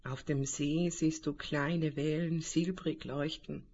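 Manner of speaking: calm guided meditation voice; right in your ear. Acted. Leitet eine Meditation an. (ruhige Meditationsstimme)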